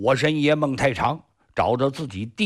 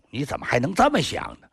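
plain reading voice

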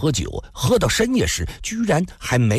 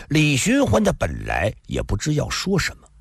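ordinary delivery, nothing marked

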